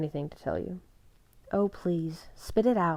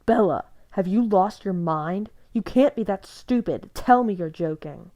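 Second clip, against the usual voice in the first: frantic anger; 2 semitones higher, 8 dB louder than usual. A narrator's reading. Jacob shakes Bella, furious and desperate. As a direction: shake her with your words.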